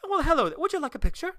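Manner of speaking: high, loud bad Scottish greeting; Merida, Scottish accent. Princess offering fans a picture, mock Scottish accent. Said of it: "Terrible Scottish accent".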